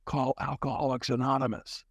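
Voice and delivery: soft, distant inner voice; a quiet voice inside his head; like a voice heard from far off; little inner voice. Retold or imitated. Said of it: "a little voice in my head said".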